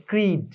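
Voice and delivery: ক্রিড said as "creed", with an ee vowel; distorted pronunciation. Shown as a demonstration. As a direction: mispronounce cried as ক্রিড, losing the "eye" sound. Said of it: "এটার উচ্চারণ হয়ে যায় ক্রিড".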